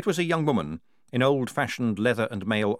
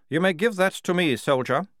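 lightly voiced captain, higher and louder; a touch of military authority; commanding captain; 9 semitones higher, 4 dB louder than usual. Captain orders a soldier.

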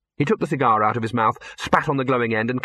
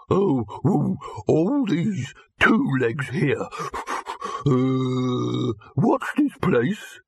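dim pedigree dog; 3 semitones higher, much slower than usual. Acted. Dog overwhelmed by all the two-legs, slow panting dog voice. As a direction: pant between words, rising on the question.

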